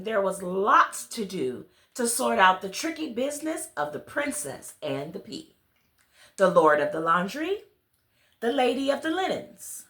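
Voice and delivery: ordinary delivery, nothing marked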